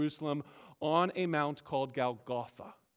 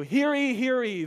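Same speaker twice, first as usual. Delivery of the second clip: loud, high town crier call; herald's cry; 7 semitones higher, 6 dB louder than usual. Retold or imitated. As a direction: proclaim it like a herald in the square. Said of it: "declare and proclaim as a herald".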